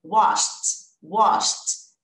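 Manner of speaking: "Washed" said wósht, one syllable ending in T; T sound added. Shown as a demonstration. Teacher drilling the past of wash for the class, crisp T. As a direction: model "Washed" with a short T after the SH. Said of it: "eu vou acrescentar o T".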